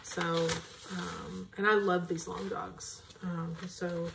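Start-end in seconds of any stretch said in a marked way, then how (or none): none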